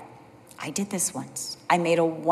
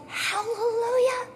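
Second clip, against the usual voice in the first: sincere praise; 7 semitones higher than usual. Retold or imitated. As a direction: bless your friend gently. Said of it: "I was like".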